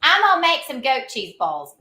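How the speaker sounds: playful put-on Southern accent. Shown as a demonstration. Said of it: "look at my Southern accent".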